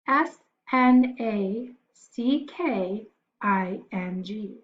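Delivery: slow, letter by letter, each letter separate; spelling it out. Shown as a demonstration. Teacher spelling the word aloud for the class, slow and letter by letter. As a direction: spell it slowly so the kids can follow. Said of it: "let's spell it out".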